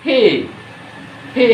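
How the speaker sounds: ফি with a forceful puff of air; correct letter P. Shown as a demonstration. Teacher drilling the correct P, breath-puffed ফি. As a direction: aspirate ফি strongly. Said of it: "পি না হয়ে কি হবে".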